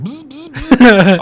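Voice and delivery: loud, high, slow drill buzzing; imitated drill noise. Coworkers miming a drill with no shared language, loud slow buzzing. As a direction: buzz like a power drill. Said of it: "but they would be like".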